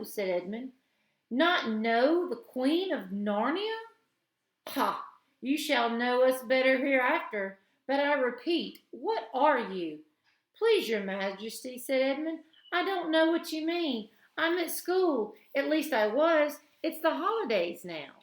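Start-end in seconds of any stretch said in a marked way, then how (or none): 1.3-10.0 haughty queen. A narrator's reading. Queen imperious. 10.6-18.1 put-on high anxious boy voice; nervous boy. A narrator's reading. Edmund pleading.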